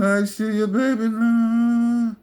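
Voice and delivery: high, slow creepy minion; creepy obsequious imitation, drawn out; Janusz. Henchman kidnapping the baby, high creepy obsequious voice. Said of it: "he's always with that creepy, obsequious tone".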